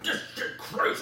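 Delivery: strained, ranting impression of a passenger; hammed-up crazed cursing; mock screaming. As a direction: rant it like a raving stranger. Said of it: "they're literally screaming and swearing".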